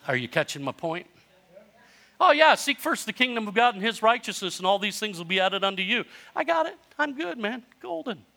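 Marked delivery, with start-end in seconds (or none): 2.2-6.0 high, breezy brush-off; voice going up. Retold or imitated. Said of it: "If anybody's voice goes up when they're telling you something". 6.4-8.2 high, squeaky fake confidence; voice going up. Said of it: "If anybody's voice goes up when they're telling you something".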